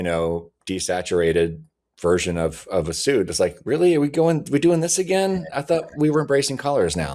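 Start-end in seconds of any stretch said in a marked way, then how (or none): 3.6-7.2 high-pitched, incredulous protest; exasperated complaint. Retold or imitated. Fan reacting to another drab suit, exasperated. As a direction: raise it into a disbelieving gripe. Voicing annoyed fans' reaction.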